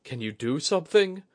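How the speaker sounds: pleading. Acted. Soldier asking the shugenja for help, pleading. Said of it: "Oguri's face was pleading".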